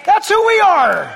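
high, loud fervent preaching; preaching shout. Real, not acted. Pastor urging the church to remember, loud high preaching. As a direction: shout it to the congregation. Preacher rising to full force.

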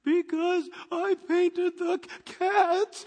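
put-on high, slow, sheepish kid; small child voice. Little Junior answering dad, a high slow sheepish kid voice. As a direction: play the guilty little boy confessing. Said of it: "Junior answers dad".

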